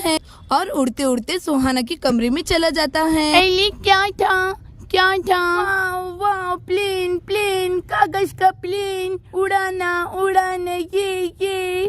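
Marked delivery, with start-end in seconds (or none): 3.3-11.9 put-on a little boy excited by a toy plane; toddler voice. Toddler Guddu sees paper plane.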